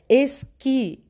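es-QUÍ, final syllable stressed; stress on QUI. Shown as a demonstration. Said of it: "la sílaba más fuerte es el qui".

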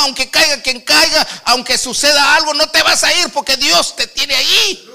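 loud fervent preaching. Acted. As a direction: drive the message home to the congregation. Preaches at full force. (predicación fervorosa y fuerte)

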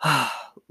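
long tired sigh; weary sigh. Acted. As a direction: sigh out exhausted. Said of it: "another weary sigh".

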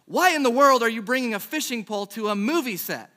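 loud, high mock outburst; incredulous demand. Improv partner confronting a clueless scene mate, loud and incredulous. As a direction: call out the challenge at full projection.

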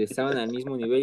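normal talking voice

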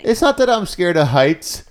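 high, drawn-out protest; defensive protest. Real, not acted. Co-host insisting it isn't fear of heights, high drawn-out protest.

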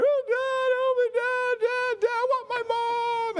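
put-on very high wailing child voice; kids crying. Children locked in the room crying for mom, high wailing. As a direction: wail like a little kid calling for mom. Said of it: "and they're crying".

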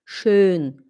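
"Schön" with a rounded ö vowel; correct pronunciation. Shown as a demonstration. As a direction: model "Schön" alone, low, with clear ö. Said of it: "é das Wetter ist schön". (pronúncia correta do ö)

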